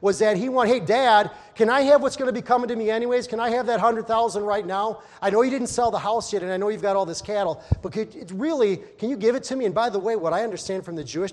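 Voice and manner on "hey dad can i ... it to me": loud, pushy plea for the money; brash younger son asking loudly; demanding son. Acted. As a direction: beg Dad for it loudly and eagerly. Son voiced demanding inheritance.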